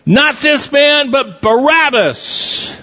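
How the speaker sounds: high, drawn-out outcry; crowd crying out. A narrator's reading. Crowd answering Pilate on whom to release, crying out. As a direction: call it out slowly, pitched well up. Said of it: "So they cried out again".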